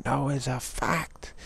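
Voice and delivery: slow, high insistent voice; an official insisting it's a fact, slow and high; mock insistence. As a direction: drag it out like a stubborn official. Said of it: "they'll say".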